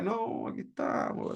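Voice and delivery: soft, slow, half-voiced downcast answer; downcast reply. Troubled person answering a concerned neighbour, downcast. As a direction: answer softly and slowly, weighed down by problems. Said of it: "cuando tú tienes problemas".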